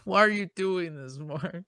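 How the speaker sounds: hammed-up fake sob with a laugh breaking through; mock weeping. Host betrayed by cohost's goodbye, mock weeping. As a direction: whimper at Mark like a wounded friend, only half serious. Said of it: "That's me weeping".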